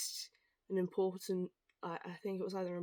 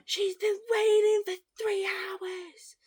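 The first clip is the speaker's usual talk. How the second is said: much higher, louder, slow whiny mimic of the coworker; mocking coworker; 11 semitones higher, 10 dB louder, slower than usual. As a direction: ham up his complaint as a mocking whine.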